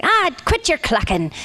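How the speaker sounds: high, slow pirate woman's scolding; pirate redhead. Acted. Redhead pirate hushing the crew on the Disney ride, pirate voice. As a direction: tell them off in a put-on pirate voice. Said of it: "and she's like".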